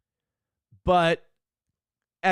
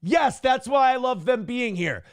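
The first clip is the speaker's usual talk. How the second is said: gleeful yes; 5 semitones higher than usual. Retold or imitated. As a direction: shout your enthusiastic agreement. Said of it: "And I'm like".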